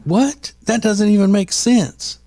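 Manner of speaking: a confused husband going what; mock baffled husband. Husband's baffled reaction quoted.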